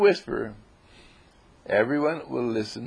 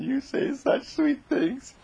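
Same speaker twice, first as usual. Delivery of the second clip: very high, slow falsetto sweetness; hammed-up adoring squeak; swooning Marion; slower than usual. A narrator's reading. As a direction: coo it like a smitten girl.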